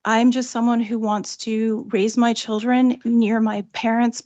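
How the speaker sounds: usual speaking voice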